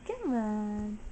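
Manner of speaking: ordinary talk, nothing marked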